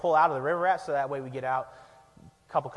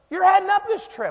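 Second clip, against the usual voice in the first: mock accusing; 14 semitones higher, 6 dB louder than usual. [name] accusing during lost drive.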